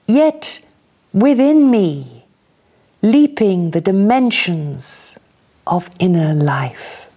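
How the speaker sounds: measured, expressive poem reading with pauses; poetry reading. Acted. Reader sharing a poem with a gathered audience, expressive reading. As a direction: read it slowly, savouring each image.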